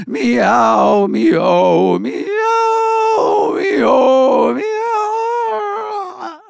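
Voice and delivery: high, yowling caterwaul; loud cat meowing. A narrator's reading. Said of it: "started meowing".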